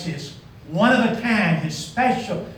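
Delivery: ordinary talk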